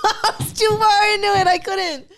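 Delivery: high squealing laugh through words. Real, not acted. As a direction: laugh while you admit it. Laughing at own mistake.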